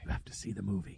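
low, very soft murmur; secretive near-whisper; hushed podcast voice. Pretend. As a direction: keep it down as if whispering.

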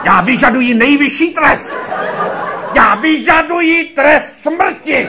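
very high, drawn-out roar; hammed-up director demanding the ultimate penalty; escalating hysterical shout. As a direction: build slowly to a screamed climax. Tirade climaxes demanding death penalty.

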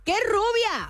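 high, outraged outburst; indignant surprise. Acted. Streamer hearing of a blonde at the burritos, mock outrage. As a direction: yelp it in mock-jealous shock. Reacts to blonde rumor about partner.

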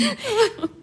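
a bright little laugh; a short, high laugh; light laugh. Acted. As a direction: laugh it off, amused.

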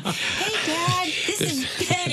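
high, singsong call to Dad; speakerphone introduction. Retold or imitated. Introducing passenger over car speakerphone.

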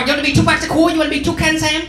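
high mocking mom grilling her son. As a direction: pitch way up and nag like an accusing mom.

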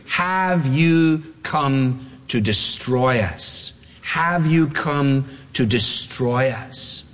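deliberate, spaced-out confrontation; slow, weighty question. Acted. Demon questioning Jesus of Nazareth, slow and deliberate. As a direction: draw each word out, slowly.

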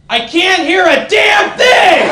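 put-on high, drawn-out old-lady screech; hammed-up hard-of-hearing old woman; heckling old woman. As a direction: yell it slowly from the back of the room. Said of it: "you hear one old woman in the back go".

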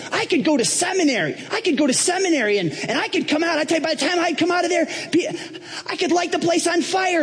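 all excited, very high-pitched boasting; Donald Trump. Acted. Trump pitching seminary plans to Paul, high and excited. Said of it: "Donald gets all excited".

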